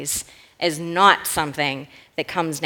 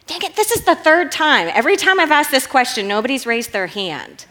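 high, loud, playful scolding that eases off; mock exasperation; 6 semitones higher, 4 dB louder than usual. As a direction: feign frustration with a crowd that won't respond. Jokingly scolds unresponsive congregation.

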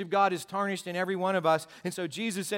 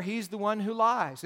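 ordinary talk

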